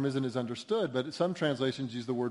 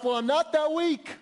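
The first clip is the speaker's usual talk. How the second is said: much higher, mocking self-assured protest; self-righteous inner voice; 9 semitones higher, 4 dB louder than usual. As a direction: imitate a proud person brushing off their need. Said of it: "keep saying to yourself".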